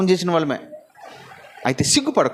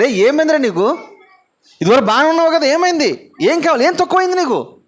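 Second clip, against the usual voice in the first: crowd asking; 7 semitones higher, 4 dB louder than usual. Retold or imitated. Crowd voiced asking blind man.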